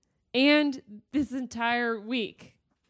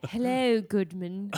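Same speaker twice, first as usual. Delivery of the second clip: attempted English accent; badly faked British greeting; 2 semitones lower than usual. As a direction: have a go at a proper English voice. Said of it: "I can't be a proper English woman".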